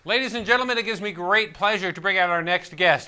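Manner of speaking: normal talking voice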